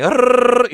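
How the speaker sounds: "Arrrr" with a long trilled rolling R, high and loud; rolled R. Shown as a demonstration. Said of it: "roll it out and be like".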